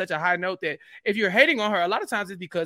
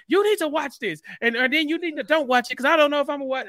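higher, pushy friend urging her to watch; Bryce, insistent; 7 semitones higher, 4 dB louder than usual. Retold or imitated. Friend on the phone urging Chappelle to watch, higher and insistent. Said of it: "He's like".

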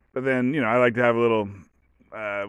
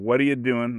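normal talking voice

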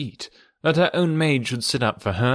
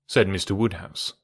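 plain reading voice

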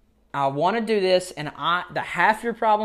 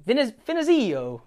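Finizio retried in a higher, sing-song Italian accent; 8 semitones higher than usual. Shown as a demonstration. Host retrying the coach's name in a higher Italian accent. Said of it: "I had to say it like an Italian as best I could".

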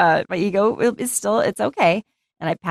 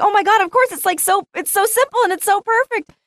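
much higher, thrilled exclamation; excited realization. Retold or imitated. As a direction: gush with delighted discovery. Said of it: "And I was like".

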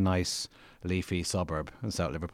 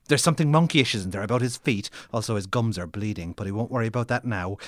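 sudden loud burst; 4 semitones higher, 6 dB louder than usual. Acted. Burst in dramatic reading.

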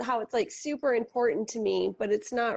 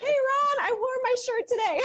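excited; 14 semitones higher than usual. Real, not acted. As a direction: call out, thrilled. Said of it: "I was really excited".

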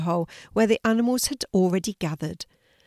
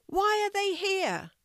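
high, drawn-out hyena voice; curious hyena; 13 semitones higher, 3 dB quieter than usual. A narrator's reading. Hyena asking about the new guests, high and curious. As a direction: ask it high and slyly curious. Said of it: "always curious of new things".

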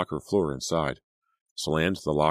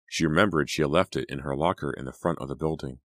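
usual speaking voice

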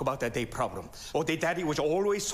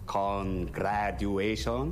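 slow, stretched Congratulations with a rising end; drawn-out congratulations; 8 semitones lower, much slower than usual. Acted. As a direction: draw out Congratulations slowly, half-asking. Repeats congratulations slowly.

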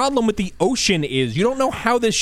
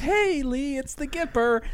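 Reagan voice; 7 semitones higher, 4 dB quieter than usual. Retold or imitated. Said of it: "Who's dressed like Reagan".

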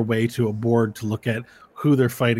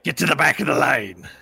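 loud, firm queue order; threatening order; 4 dB louder than usual. Acted. Tabaxi confronts fighter in line, threatening. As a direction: order them back forcefully.

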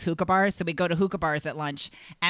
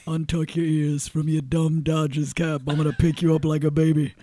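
imitating [name]; 3 dB louder than usual. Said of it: "So he'd be like".

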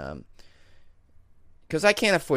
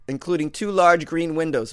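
usual speaking voice

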